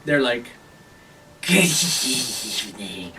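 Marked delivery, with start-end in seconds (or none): 1.4-3.1 slow, drawn-out whispery creature voice; kobold toll-taker. Acted. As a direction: stretch out the demand in a kobold's half-whisper. Game master voices kobold demanding toll.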